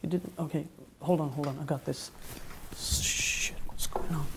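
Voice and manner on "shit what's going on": hushed, frightened whisper; scared whisper. Acted. Hiding from the men next door, scared whisper.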